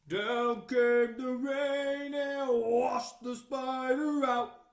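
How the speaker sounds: put-on slow, loud read-aloud for children; storybook reading. A narrator's reading. Reading the book page aloud to children, slow and loud. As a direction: read it out big and slow for little listeners.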